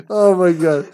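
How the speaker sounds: breathless teary laughter; laughing to tears. Real, not acted. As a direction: talk through tears of laughter.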